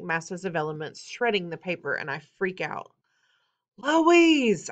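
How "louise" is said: loud, high, drawn-out holler; freaked-out yell, falling at the end; bellow. A narrator's reading. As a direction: bellow the name in exasperation. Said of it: "I bellow".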